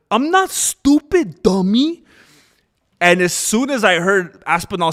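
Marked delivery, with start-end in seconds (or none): 0.1-2.0 high, loud, slow schoolyard jeer; sing-song it like a kid on the playground; fourth-grade taunt. Retold or imitated. Streamer mocks the line as a fourth grader would say it, high and loud. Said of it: "something that we would say in, like, fourth grade".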